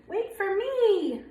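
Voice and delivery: high, slow wait for me; duckling calls to his siblings, high and slow; eager call. Acted. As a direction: call after the others, eager. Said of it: "he cried".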